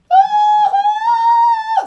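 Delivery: very high, held squeal; loud sustained squeak in two bursts. Real, not acted. Playing about while doing makeup, high squeal.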